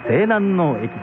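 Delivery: plain reading voice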